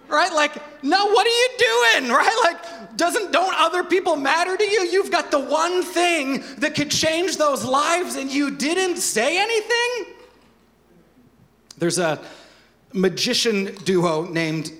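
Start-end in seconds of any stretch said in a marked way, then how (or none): 0.1-10.1 high, loud disbelief with a laugh; hammed-up high-pitched disbelief; mock outrage. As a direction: act out being baffled that they never told anyone. Incredulous outrage at the withholder.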